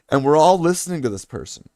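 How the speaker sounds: ordinary talk, nothing marked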